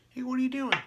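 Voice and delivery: high voice of a fish. Retold or imitated. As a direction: squeak it out like a puzzled fish. Fish looking at him.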